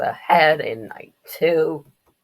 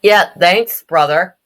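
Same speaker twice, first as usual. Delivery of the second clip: raised, louder mock reply; loud retort; 4 semitones higher, 14 dB louder than usual. Injured kid answering brother's apology, loud retort. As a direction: throw it back loudly.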